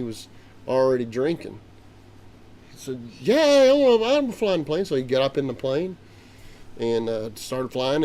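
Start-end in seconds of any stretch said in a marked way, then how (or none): 3.2-4.8 put-on high, loud drunken boast. The drunk pilot boasts loudly about flying. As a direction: brag loudly that you fly. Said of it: "'cause he's talking real loud".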